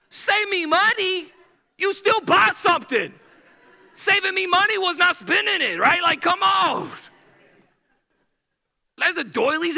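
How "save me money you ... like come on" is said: high, loud mock disbelief; incredulous. Husband scoffing at his wife's bargain. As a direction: scoff at her bargain with a laugh.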